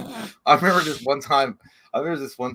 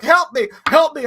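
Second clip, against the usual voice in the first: high, loud, drawn-out begging; frantic plea; 11 semitones higher, 10 dB louder than usual. Retold or imitated. Mom holding the dog, loud frantic plea. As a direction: imitate a panicked mother crying for help. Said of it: "And she's like".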